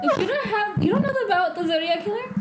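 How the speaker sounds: high, laughing disbelief; can't believe it, laugh through it; surprised. Real, not acted. Disbelief friend doesn't know.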